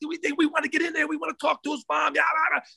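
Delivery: high-pitched mock complaining; mimicked mad protesting. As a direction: imitate angry men clamoring to get in. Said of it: "Oh, they were mad".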